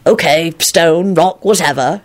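slow, mildly embarrassed mutter; mortified mutter. A narrator's reading. Lupin corrected by Elizabeth, mildly mortified mutter. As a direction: drag out the words, a touch mortified. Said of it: "he muttered, obviously mortified".

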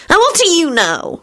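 high-pitched, indignant shriek; piercingly high challenge. A narrator's reading. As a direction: shriek it without much volume. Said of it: "Solange shrieked".

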